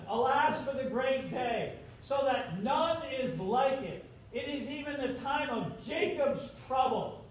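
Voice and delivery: solemn scripture reading. Acted. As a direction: intone the prophecy gravely. Reading Jeremiah aloud.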